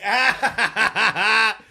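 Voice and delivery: hearty laugh. Real, not acted. Laughing at a comment.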